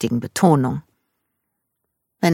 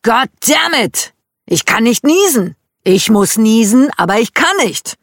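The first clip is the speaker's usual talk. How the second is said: higher and louder, exasperated complaint; a man desperate to sneeze, shouting his frustration; frustrated yelling; 6 semitones higher, 5 dB louder than usual. Retold or imitated. Dad frustrated, cannot sneeze.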